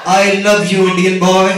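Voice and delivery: loud, laughing mock declaration of love. Knife attacker melting at the heart power, loud laughing mock love. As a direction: blurt it out like a goofy cartoon convert. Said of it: "attacker won over by heart".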